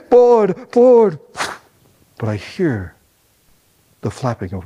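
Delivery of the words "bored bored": nervous loud repeat. Retold or imitated. Nervous boy under pressure.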